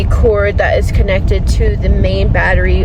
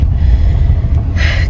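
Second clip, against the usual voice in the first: heavy sigh. Real, not acted. Worried about ordering new panel.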